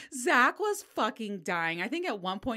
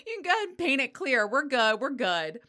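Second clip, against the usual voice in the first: laughing; 6 semitones higher, 6 dB louder than usual. Retold or imitated. Laughing reply to nail tech.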